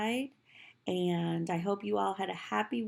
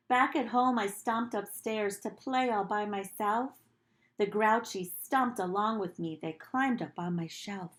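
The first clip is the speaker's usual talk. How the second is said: put-on gentle read-aloud to children; storybook reading; 3 dB louder than usual. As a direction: read it like storytime for little kids. Teacher reads picture book aloud.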